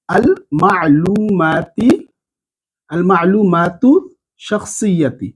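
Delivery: আল মা'লুমাতু said very slowly with a rising end; slow drilled term. Shown as a demonstration. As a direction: drill the ending vowel slowly. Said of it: "জবর জের লাগিয়েও নিতে পারবেন". (ধীরে জবরসহ উচ্চারণ)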